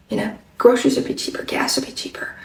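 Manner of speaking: breathy whisper, voice dropped away; whispered complaint. Retold or imitated. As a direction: confide it under your breath. Shopper confiding her complaint.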